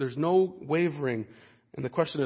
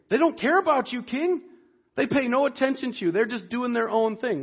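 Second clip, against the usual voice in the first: much higher, whiny tattletale voice; tattling accusers; 7 semitones higher than usual. Retold or imitated. Preacher voicing jealous astrologers complaining to the king, high and whiny. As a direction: whine it up high like snitches telling on someone.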